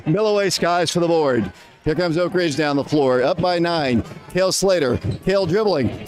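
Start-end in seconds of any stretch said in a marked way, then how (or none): none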